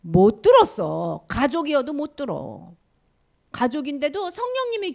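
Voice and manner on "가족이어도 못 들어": ordinary talk, nothing marked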